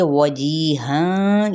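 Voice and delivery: वजीहां very slowly, with half-rounded lips on the waw; slow model reading of वजीहां; tajweed recitation. Shown as a demonstration. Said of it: "वाव होंठों की ना तमाम गुलाई के साथ".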